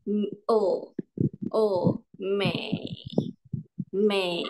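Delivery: teacher models third tone Mei; third tone demo. Shown as a demonstration. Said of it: "kalau nada tiga".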